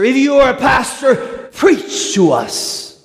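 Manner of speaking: high shout, then slowed; drunk's dare. Retold or imitated. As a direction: bellow the dare, then stretch it. Drunk daring pastor to preach.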